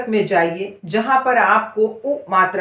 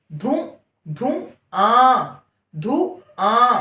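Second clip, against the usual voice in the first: slow syllable reading; much slower than usual. Shown as a demonstration. Teacher to pupil, drawn-out syllables. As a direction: stretch each syllable out slowly. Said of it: "reading syllables slowly".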